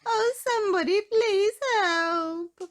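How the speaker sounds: high, loud, slow pleading cry; tiny mouse pleading for rescue from the cat; desperate squeak. A narrator's reading. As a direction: beg for help in terror. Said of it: "shrieked Miss Tiny at the top of her voice".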